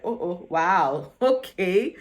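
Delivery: slow, drawn-out imitation of shock; mock shocked. Ricky picked from the lucky dip, imitated shock. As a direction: stretch out a stunned reaction. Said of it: "Ricky was shocked".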